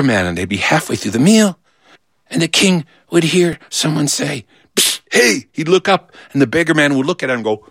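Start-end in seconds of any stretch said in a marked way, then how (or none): 4.8-5.5 hissed psst, then raised hey; furtive call. Acted. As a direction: get his attention across the table. Beggar secretly catches king's attention.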